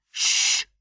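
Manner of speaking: sharp hushing hiss; boy hushing his mother by the cage; shush. A narrator's reading. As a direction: shush her urgently.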